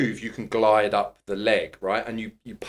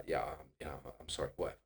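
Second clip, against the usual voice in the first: quiet, mocking yeah yeah whatever; muttered brush-off; 7 semitones lower, 16 dB quieter, much faster than usual. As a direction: therapist mock-dismissing the client's apology, mumbled and quiet. Mock brushing off the client.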